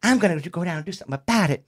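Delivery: high-pitched mock female complaint; exasperated girlfriend voice, imitated; imitated fed-up girlfriend. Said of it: "she says".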